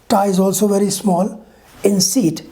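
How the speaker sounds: usual speaking voice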